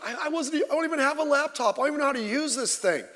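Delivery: high, fast whiny wail; imitating crying girl. Girl crying over a hotel bill, high and fast. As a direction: mimic a tearful teen in a rush. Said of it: "she was crying like".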